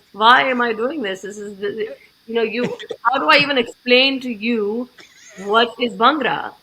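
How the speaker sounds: put-on loud, exasperated Indian accent. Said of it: "the entire Indian accent came out".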